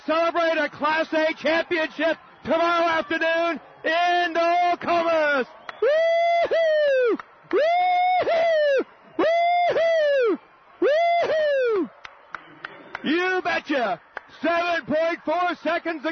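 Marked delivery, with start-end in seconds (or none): 5.8-10.4 long, high, loud falling whoop of joy; announcer's jubilant whoop as the title is won; excited whoop. Real, not acted. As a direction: let out a big victory woo-hoo. Celebrating championship on air.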